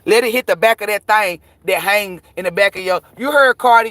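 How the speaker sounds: ordinary delivery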